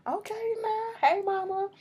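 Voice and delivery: high, slow, drawn-out okay now; sassy teasing. Real, not acted. Reacting to the young mama, teasing high and slow. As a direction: tease it way up high, slowly.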